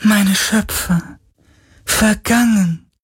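very high, slow, wailing lament; a spirit wailing for its lost makers; tormented soul. Acted. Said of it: "klagte der Geist".

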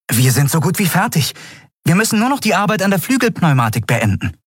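put-on very high, fast boyish voice; young Miko, eager. A narrator's reading. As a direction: rush the caring question out. Boy Miko reports work. (hohe Jungenstimme, schnell und besorgt)

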